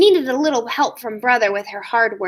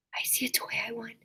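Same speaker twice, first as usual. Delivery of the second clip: eager whisper; 5 semitones lower, 16 dB quieter, much faster than usual. Acted. Excited cub peering at toys.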